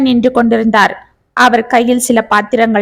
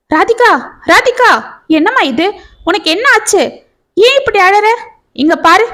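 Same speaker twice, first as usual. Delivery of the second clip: higher-pitched, urgent worried questions; acted alarm at someone breaking down; alarmed concern; 7 semitones higher than usual. A narrator's reading. Kannan to weeping Radhika, asking what happened, alarmed. Man alarmed at woman suddenly crying.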